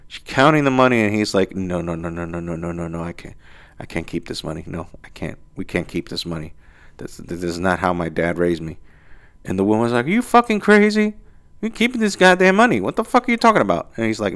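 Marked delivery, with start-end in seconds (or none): 1.5-8.8 soft, hushed no, no, no; quiet refusal. Retold or imitated. Honest guy softly refusing to keep found money. 10.1-13.9 high, indignant disbelief, fast at the end; outraged girlfriend. Retold or imitated. Girlfriend scolding him for giving back the money, high and indignant.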